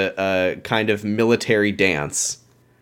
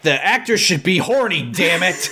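higher, playfully outraged protest; mock indignation; 6 semitones higher than usual. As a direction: protest in jokey, hammed-up exasperation. Jokey indignation about the casting.

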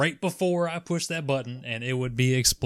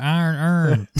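put-on Baltimore accent; 2 semitones higher than usual. Teasing a cohost, in a mock Baltimore accent. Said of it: "Throw that Baltimore".

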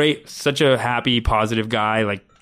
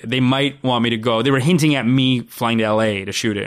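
ordinary talk, nothing marked

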